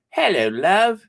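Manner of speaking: put-on cheery hello in playful East End accent. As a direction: put on your best London East End. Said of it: "That's my best East End accent".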